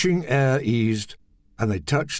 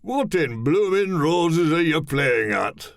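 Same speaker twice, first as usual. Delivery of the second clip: slow, higher put-on voice for the skinny man; lightly raised odd voice, slow; tall man's voice; 5 semitones higher than usual. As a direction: speak through a hand over the mouth, slowly.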